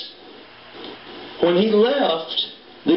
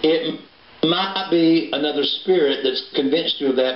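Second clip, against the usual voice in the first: ordinary talk, nothing marked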